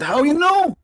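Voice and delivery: sudden high, loud astonishment; astonished question. Retold or imitated. Guy amazed his name is known.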